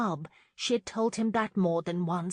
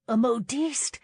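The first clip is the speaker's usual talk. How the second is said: high, louder disbelieving question; shocked gasp; 5 semitones higher, 6 dB louder, slower than usual. A narrator's reading. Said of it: "Lauren gasped".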